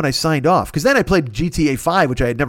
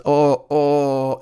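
mild mock groans; quick pitiful groan; fake sick moaning; 3 semitones lower than usual. Sick on stream, mild mock groaning. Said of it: "I was just".

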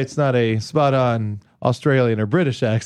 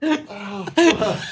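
pained; 7 semitones higher than usual. Real, not acted. Knee hurts.

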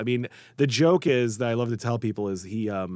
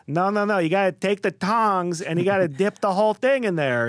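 put-on higher and louder Chicago accent, insisting; bossy old Chicago accent, showing the boy how; 8 semitones higher, 4 dB louder than usual. Grandfather teaching the kid to dip the Italian beef, Chicago accent. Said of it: "he had a very thick Chicago accent".

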